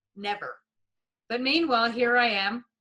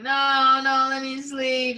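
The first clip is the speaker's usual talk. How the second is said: louder, higher no, no, let me sleep; pleading protest; 4 semitones higher, 7 dB louder, slower than usual. Retold or imitated. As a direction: beg to be left alone to sleep. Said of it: "And I remember going".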